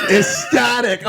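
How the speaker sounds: high, laughing and slowed with excitement; retelling a winning shot, laughing high; ecstatic. Retold or imitated. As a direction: gush with giddy excitement.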